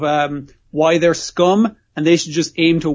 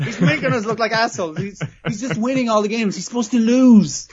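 mock whiny staff; 6 semitones higher than usual. Staff complaining about Kaiji.

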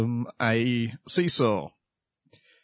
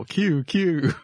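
put-on weird old man voice; 6 semitones higher than usual. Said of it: "fucking weird old man voice".

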